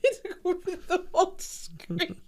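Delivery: high, slow, laughing through the words. Real, not acted. Laughing retelling of meme.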